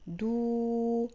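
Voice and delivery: "دو" held as long, steady doo; drawn-out syllable "دو"; long vowel. Shown as a demonstration. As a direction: stretch the vowel of "دو" evenly. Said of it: "هو صوت طويل".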